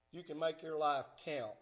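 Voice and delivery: ordinary talk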